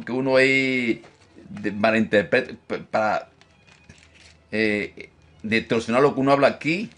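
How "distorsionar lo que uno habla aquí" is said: usual speaking voice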